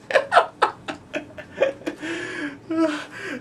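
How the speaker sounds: long, high manic cackle; maniacal laugh. Acted. Joker after his multiple choice joke, cackling madly. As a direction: laugh wildly at your own punchline.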